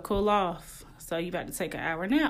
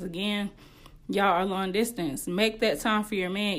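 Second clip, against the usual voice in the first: ordinary talk, nothing marked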